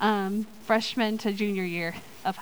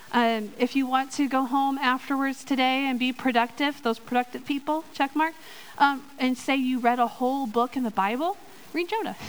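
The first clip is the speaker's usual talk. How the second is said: ordinary talk, nothing marked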